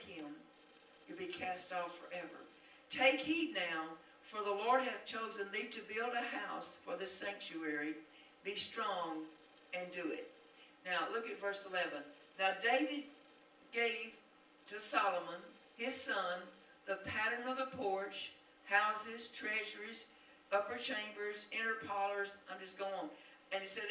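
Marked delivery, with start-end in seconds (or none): 2.9-10.3 loud, declaiming preacher's reading; scripture read with force. Acted. Preacher reading scripture to the congregation, declaiming loudly. 12.4-22.3 loud, declaiming preacher's reading; scripture read with force. Acted. Preacher reading scripture to the congregation, declaiming loudly.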